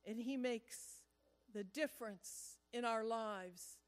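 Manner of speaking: earnest pastoral prayer voice. Acted. Pastor leading the congregation in closing prayer, earnest.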